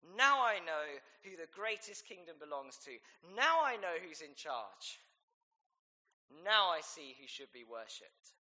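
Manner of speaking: loud, ringing declaration; proclaiming. Pretend. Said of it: "He looks up and he says".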